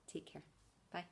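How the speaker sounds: ordinary talk, nothing marked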